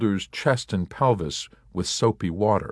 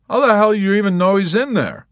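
high, loud challenging question; Yossarian; 13 semitones higher, 9 dB louder than usual. A narrator's reading. Yossarian challenging the nurse, loud and high. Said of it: "he asked her".

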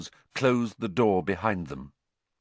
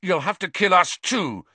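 higher, defiant and firm; fiercely; 7 semitones higher than usual. Acted. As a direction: stand up to him despite the pain. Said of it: "he said fiercely".

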